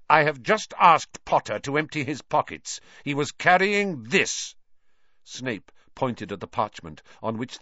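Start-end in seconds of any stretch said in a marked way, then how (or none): 0.1-4.6 cold fury. Acted. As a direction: spit out each word in anger. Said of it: "his face contorted with fury".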